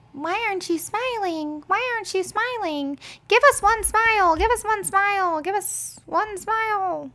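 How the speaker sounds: hammed-up sleazy men calling out; mocking catcallers. Men catcalling her on street.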